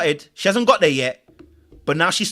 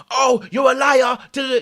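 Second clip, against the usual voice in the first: mock angry shouting; 6 semitones higher, 5 dB louder than usual. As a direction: act out a shouted outburst. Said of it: "getting angry and shouting".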